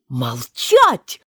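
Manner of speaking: low, loud barked command; furious yell. Acted. Purple queen yells at Alice, furious. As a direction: roar her silent. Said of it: "крикнула королева багровее".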